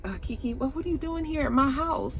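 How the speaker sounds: high, soft, startled question; hushed and high, caught off guard; startled Mel. Retold or imitated. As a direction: Mel surprised to find Kiki at his house, high and soft. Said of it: "he was just like".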